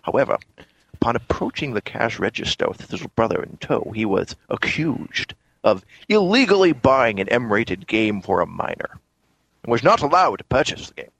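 slurred Scottish film-star impression; Sean Connery. Co-host reading the news post on request, Connery impression. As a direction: read it out as a passable Scottish screen legend. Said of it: "a passable Sean Connery for you".

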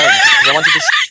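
breaking into laughter mid-sentence; words cracking into a laugh. Real, not acted. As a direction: laugh through the words, pitch jumping up. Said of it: "yes, [name]'s laughing".

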